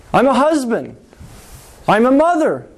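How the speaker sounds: ordinary delivery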